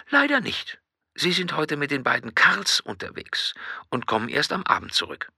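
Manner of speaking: high, then dropping to a whisper; whispery Edna on the phone; Edna's voice. Acted.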